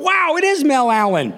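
put-on high-pitched starstruck kid; awestruck. Child awestruck meeting radio idol.

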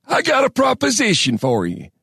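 put-on high, creaky old prospector; harsh, gravelly old man. A narrator's reading. Old man repeating his offer to miners, harsh high voice. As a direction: announce it in a high, wheezy old voice. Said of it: "cleared his throat laboriously".

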